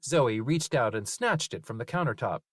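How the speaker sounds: usual speaking voice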